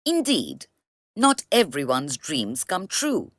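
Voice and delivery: plain reading voice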